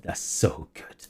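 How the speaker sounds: breathless whisper; whispered delight. Real, not acted. DM delighting at the player's answer, whispered. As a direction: breathe it out in awe.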